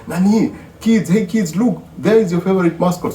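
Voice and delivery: put-on brightly calling the kids over; high mock-cheerful mom call; voicing the mom calling kids. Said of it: "She goes like".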